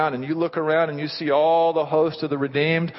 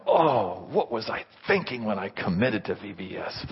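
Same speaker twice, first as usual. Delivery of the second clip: regretful groan; 2 semitones lower than usual. Acted. Volunteer waking up regretting Bible school, groaning. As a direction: groan it out with dread. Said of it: "don't be getting up tomorrow morning and go".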